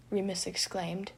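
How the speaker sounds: ordinary delivery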